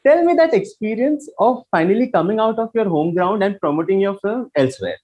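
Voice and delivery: normal talking voice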